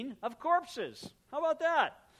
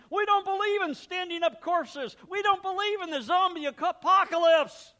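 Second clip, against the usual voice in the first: much higher, much louder, hammed-up protest; mock indignant Corinthians; 11 semitones higher, 13 dB louder than usual. Preacher playing the Corinthians denying zombie corpses, hammed-up loud high protest. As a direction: wave it off like a scandalized church crowd.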